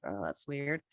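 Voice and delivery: soft, dropped "that's weird" aside; muttered mock surprise. Peruvian interpreter hearing Mexican terms, quiet mock surprise. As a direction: mutter it under your breath. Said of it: "and I'm like".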